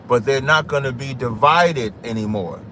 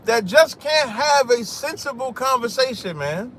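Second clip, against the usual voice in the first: exasperated; 9 semitones higher than usual. Real, not acted. Livestream ruined by a caller.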